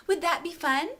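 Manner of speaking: lightly voiced three-year-old, bright rising question; sweet child's question. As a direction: ask it like an earnest little girl. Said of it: "she looks at me and says".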